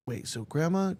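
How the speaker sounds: ordinary delivery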